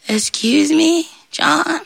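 high, small, polite voice; meek devil. Acted. Devil stuck in the bush calls John, meekly. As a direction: ask timidly, high up.

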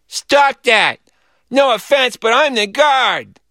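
put-on high, slow small-child voice; little boy guard. A narrator's reading. Small boy interrupting the robbers on stage, childish bravado. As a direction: pipe up like a little kid playing guard. Four-year-old playing the guard.

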